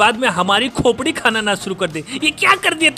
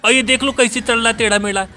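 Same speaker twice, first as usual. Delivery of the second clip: ordinary talk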